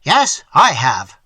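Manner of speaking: loud and slow, defiant; excited. A narrator's reading. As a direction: fling back the admission. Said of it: "replied Quint excitedly".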